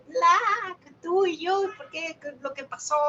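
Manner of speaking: high-pitched imitation of an angry person's outburst; mock angry yelling. Someone very angry confronts the teacher, mock yelling. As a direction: act out someone yelling at you, half-hearted. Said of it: "Cuando alguien me grita, está muy enojada".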